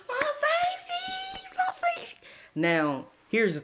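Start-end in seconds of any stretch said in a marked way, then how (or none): 0.1-2.1 high wailing mock sob; crying mom. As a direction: wail it like a weeping mother. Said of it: "she comes up to me and she goes".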